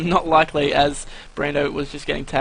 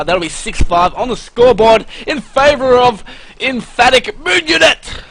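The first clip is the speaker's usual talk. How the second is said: much higher and louder, hyped; excited handover; 9 semitones higher, 9 dB louder than usual. Real, not acted. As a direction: pump it up as you pass to the partner. Hyped handover after the round.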